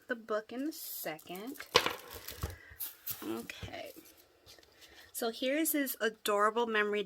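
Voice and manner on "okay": soft whispered aside; whispered to yourself. Real, not acted. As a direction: murmur it under your breath. Pausing to set things aside.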